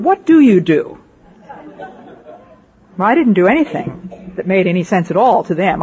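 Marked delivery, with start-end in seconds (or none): 0.0-1.0 louder, higher exasperated question; mock exasperation. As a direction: throw up your hands at a hopeless newcomer. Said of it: "threw up their hands and they said".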